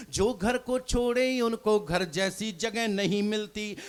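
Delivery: measured recited couplet, each line dwelt on; poem recitation. Acted. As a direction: recite it like a stage poet. Poet reciting verse on stage.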